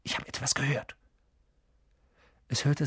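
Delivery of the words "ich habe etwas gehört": soft, unvoiced warning; hissed whisper. A narrator's reading. Harry has heard something in the dark, whispering. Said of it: "zischte Harry".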